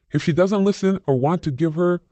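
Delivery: ordinary delivery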